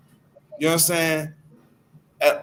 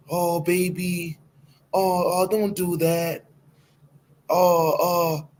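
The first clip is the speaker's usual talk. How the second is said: coddling mom; 2 semitones higher, slower than usual. Retold or imitated. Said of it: "that coddling love that she give him".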